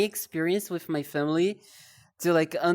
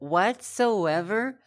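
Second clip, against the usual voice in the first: ordinary talk, nothing marked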